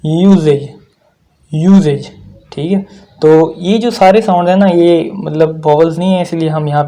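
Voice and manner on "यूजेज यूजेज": यूजेज said with a clear yoo start and falling end; teacher demo word. Shown as a demonstration. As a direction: model यूजेज twice, crisply. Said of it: "ये sound produce करता है यू वाला".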